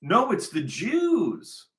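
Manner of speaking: high, drawn-out mock wail; mocking imitation. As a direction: parrot them in a high, slow whine. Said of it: "And they'll go".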